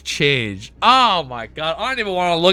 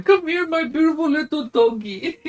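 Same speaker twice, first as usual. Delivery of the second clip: much higher cooing pet voice; 12 semitones higher than usual. Real, not acted. Talking to his dog Ellie.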